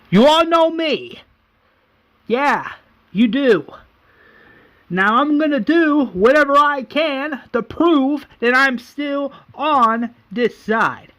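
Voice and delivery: high, loud, drawn-out declaration; showman addressing crowd. Acted. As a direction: proclaim it to a whole arena. Acting Merle addressing the crowd.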